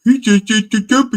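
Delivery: very high, loud squawking voice; shrieky mock commenter, each beat punched; mocked online viewers. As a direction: mimic outraged tattletales far up in pitch. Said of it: "those people on Rumble going".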